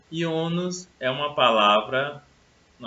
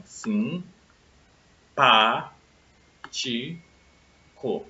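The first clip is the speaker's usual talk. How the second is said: sim, pá, ti, co with pauses, pá punched louder; separated syllables, stress on pá; 4 dB louder, much slower than usual. Shown as a demonstration. Teacher showing the tonic syllable, stress on pá. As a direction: say sim, pá, ti, co one at a time, hitting pá hard. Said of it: "esse pá que eu pronunciei com maior força".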